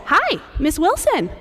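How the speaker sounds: imitating Sam, high and slow; a teen's sing-song hello, imitated; flat cheerful greeting. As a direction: mimic his bright greeting. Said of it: "he talks like this".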